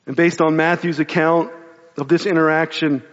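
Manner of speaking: ordinary delivery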